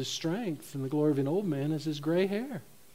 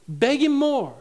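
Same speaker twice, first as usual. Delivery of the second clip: higher, louder push on the plea; mock urging; 7 semitones higher, 6 dB louder, slower than usual. Preacher mimics those teaching people to beg harder, mock urging. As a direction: urge them harder, hammed-up.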